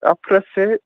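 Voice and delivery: put-on Scottish accent; hammed-up Scottish voice. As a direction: announce it in playful Scots. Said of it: "talk with my Scottish accent".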